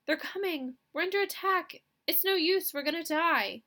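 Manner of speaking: high, loud panicked wail. Acted. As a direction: cry out as if the end has come. Panic, attack in the skit.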